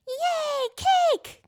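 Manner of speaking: very high, very loud squeal; excited robot yell. A narrator's reading. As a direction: shriek with pure glee. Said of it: "Guri yelled".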